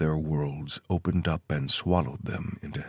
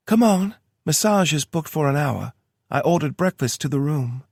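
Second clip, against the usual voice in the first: lightly higher woman's voice; Tara voice; 10 semitones higher, 6 dB louder than usual. A narrator's reading. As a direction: read her lines a bit higher. Tara's voice in story.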